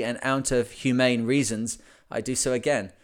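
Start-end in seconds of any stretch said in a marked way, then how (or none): none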